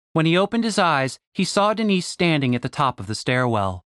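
ordinary delivery, nothing marked

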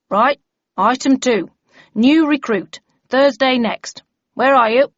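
bellman calling roll. Acted. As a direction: call out briskly across the room.

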